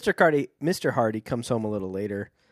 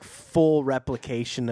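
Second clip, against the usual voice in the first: ordinary talk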